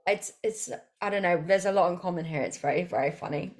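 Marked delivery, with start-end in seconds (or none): none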